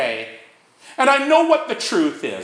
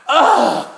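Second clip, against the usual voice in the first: disgusted ugh; 3 semitones higher, 5 dB louder than usual. Acted. Disgust at own defects.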